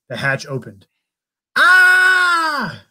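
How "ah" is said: high loud shriek; creature shriek. Acted. Frightened creature shrieking at the explorers, high and loud. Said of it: "A shriek sounded".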